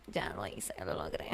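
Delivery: ya lo hice dropped to a hush, ya lo logré whispered; self-satisfied whispered victory. Real, not acted. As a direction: breathe it out, private triumph. Quiet triumphant aside.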